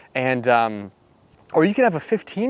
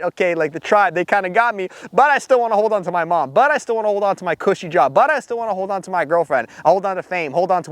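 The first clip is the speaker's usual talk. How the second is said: mock whiny excuse; 6 semitones higher than usual. Mocking half-committed man's excuses.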